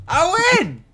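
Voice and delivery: high, loud victory shout; triumphant shout. Real, not acted. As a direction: crow over your win. Wins the race.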